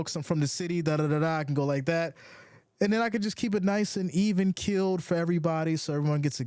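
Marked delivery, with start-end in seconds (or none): 0.2-1.4 quick city talk, rushed as a demo; sped-up demo. Shown as a demonstration. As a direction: speed it up like a city guy. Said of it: "I could speed it up".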